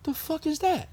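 high, quiet disbelief; can't believe what he's seeing; baffled. Retold or imitated. Driver reads the free water sign, high baffled mutter. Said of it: "I'm like".

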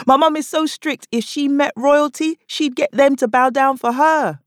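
high, punchy comic delivery; stand-up bit. Acted. Comic to English women in crowd, high punchy delivery. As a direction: sell the joke up high.

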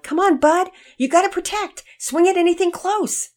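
high, loud urging from a dad; nervous yell. A narrator's reading. Nervous dad to son at the plate, high urgent yell. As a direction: yell anxious advice to the batter. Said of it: "nervousness in Daddy Peters' voice as he yelled".

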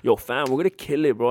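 light imitation of a Brampton accent, hyped. Imitating a friend's Brampton accent when they first met, mild Brampton accent. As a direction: mimic a friend's Brampton slang with easy swagger. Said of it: "he had the Brampton accent".

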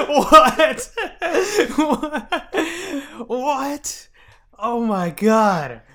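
high laughing what, said three times, then a slow oh my God; stunned and cracking up at the price; laughing disbelief. Real, not acted. Hearing the Funko Pop is worth seven hundred, laughing disbelief.

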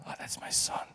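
breathy hush of a proud mom; a hint of a proud mother; whispered proud mother. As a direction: whisper it as his mother in heaven. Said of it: "she's bragging and praying over me".

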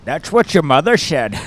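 Sean Connery. Acted. Butting into the story with a jibe, mock Scottish spy. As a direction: heckle with slushy Scottish s's. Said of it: "Sean Connery is, uh, the Scotsman".

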